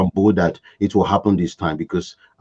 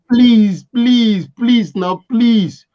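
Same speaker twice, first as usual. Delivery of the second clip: high pleading please; beg, please, please; begging; 11 semitones higher, 4 dB louder, much slower than usual. Retold or imitated. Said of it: "She was begging".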